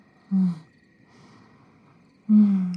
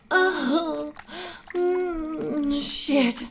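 high breathy gasp; 8 semitones higher, 5 dB quieter, much slower than usual. Acted. Pitch +8, soft.

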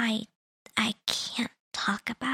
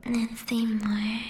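right in your ear. Acted. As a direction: murmur it right into their ear. Close soothing whisper guiding.

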